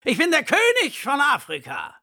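boastful bellowing lion; lion's roar. A narrator's reading. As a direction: roar it out like the king of beasts. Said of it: "brüllt der Löwe".

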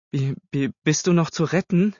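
high, stuttering disbelief; aghast stammer. A narrator's reading. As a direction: stumble on the first word, aghast. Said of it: "stammelte sie". (entgeistert stammeln, mit hoher Stimme)

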